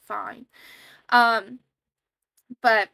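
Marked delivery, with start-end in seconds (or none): 0.0-0.5 reluctant sigh. Retold or imitated. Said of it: "it's just like".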